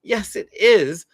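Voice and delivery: put-on the kid feels understood and agrees; relieved agreement. Kid who feels seen agrees slowly, a touch relieved. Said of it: "feel totally seen and say".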